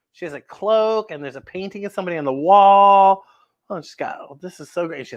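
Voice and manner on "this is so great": usual speaking voice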